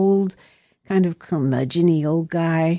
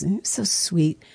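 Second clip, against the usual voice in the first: ordinary talk, nothing marked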